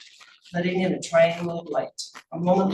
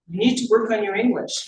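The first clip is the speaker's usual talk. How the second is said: higher, louder, firmly superior tone; strong, clear, superior; 4 semitones higher, 5 dB louder than usual. A narrator's reading. Principal scolds immigrant janitor, strong and superior. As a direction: talk down with clear authority. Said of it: "strong and clear".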